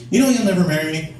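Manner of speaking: put-on moderately high girlfriend voice. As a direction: pitch up lightly as the girlfriend. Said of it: "she's a higher voice in the car".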